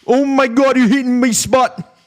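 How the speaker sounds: very high, loud mock Irish woman; Irish accent. Irish woman crying out in bed, squealed Irish accent. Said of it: "You guys know Irish".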